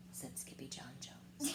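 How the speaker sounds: whispered softly; bedtime whisper. A narrator's reading.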